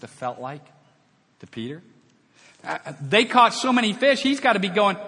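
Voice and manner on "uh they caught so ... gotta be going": much higher, louder, gleeful shout of delight; excited fisherman. Acted. Peter thrilled over a huge catch, gleeful. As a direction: cheer like you just hit the jackpot.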